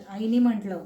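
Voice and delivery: plain reading voice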